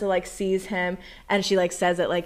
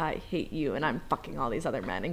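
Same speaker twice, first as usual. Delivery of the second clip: low, quieter deadpan; mock cold dismissal; 6 semitones lower, 8 dB quieter than usual. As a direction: drop down into a retold hateful brush-off. Contrasting her cold words with vow.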